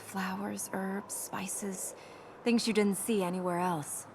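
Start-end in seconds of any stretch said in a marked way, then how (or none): 0.0-1.9 soft, breathy remembering; hushed memory of lost garden; wistful whisper. Real, not acted. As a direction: let the words fade into a whisper. Mourning mother's garden.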